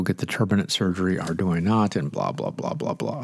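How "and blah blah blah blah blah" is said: low, trailing dismissive blah blah; dismissive mumble. Real, not acted. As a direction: mumble it off, can't be bothered. Brushing off his surgery dilemma.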